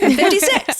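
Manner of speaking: high, loud proud announcement; proud declaration. Shown as a demonstration. Woman announcing her new age to friends, proudly loud. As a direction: shout your age like a triumph. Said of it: "learning to say it without any cringing".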